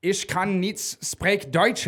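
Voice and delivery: slow, broken German; bad German. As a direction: stumble through the German slowly. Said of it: "I was like".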